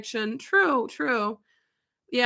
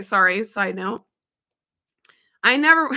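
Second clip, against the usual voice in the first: normal talking voice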